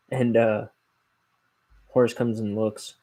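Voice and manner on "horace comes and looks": normal talking voice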